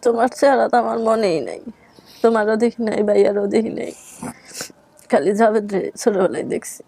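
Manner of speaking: ordinary talk, nothing marked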